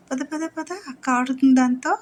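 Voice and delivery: high, eager come-on; coaxing call. Real, not acted. Owner urging dog outside, high eager call. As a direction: lure her out excitedly.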